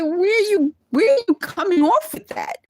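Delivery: high, pointed mock challenge; incredulous challenge. Imagined coworkers questioning her fake Southern manner, high and incredulous. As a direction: toss it back like a skeptical coworker.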